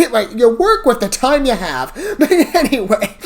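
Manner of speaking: real amused laugh running through the words. Real, not acted. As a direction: laugh it off as you speak. Laughing at own excuse.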